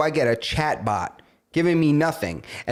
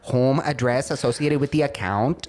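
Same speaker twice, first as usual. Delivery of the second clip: put-on apologetic Filipino-accented agent; Manila call-center accent; 3 semitones lower than usual. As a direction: imitate a courteous Manila phone agent's accent. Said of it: "Give me that guy. He's like".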